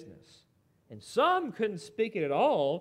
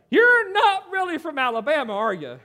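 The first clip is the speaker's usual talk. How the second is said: loud, high teasing challenge; mock disbelief; 6 semitones higher, 8 dB louder than usual. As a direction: call him out loudly, starting high. Voicing the class's teasing disbelief.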